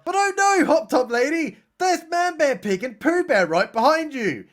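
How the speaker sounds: high-pitched theatrical alarm; hammed-up urgent cry; mock warning. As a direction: warn a film victim in pretend panic. Mock warning to hot tub victim.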